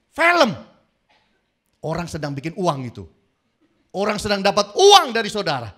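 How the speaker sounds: high, loud, fast scolding; preacher teasing the congregation about wasted tears; preaching at full force. Acted. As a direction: hammer the point with mock outrage. Preacher mocks movie crying.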